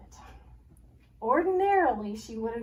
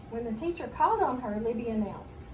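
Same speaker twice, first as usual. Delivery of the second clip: plain reading voice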